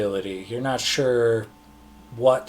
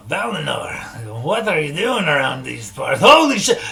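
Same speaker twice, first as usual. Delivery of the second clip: a dwarf calls down the hall, jumping to a much higher, louder yelp; lightly voiced dwarf, surprised, rising to a shout; dwarf calling out; 4 semitones higher, 5 dB louder than usual. Dwarf spotting an old friend down the hall, calls out in surprise. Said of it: "it kind of calls out".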